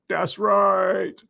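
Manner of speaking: drawn-out affirmation in an altered voice; put-on catchphrase, subdued. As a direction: stretch the catchphrase in an understated put-on voice. Catchphrase repeated, lower and softer.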